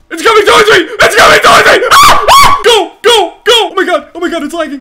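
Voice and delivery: shrieking in real panic as the shark charges; panicked screaming. Real, not acted. Player terrified of a charging shark, panicked screaming. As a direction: scream and yell go at full volume.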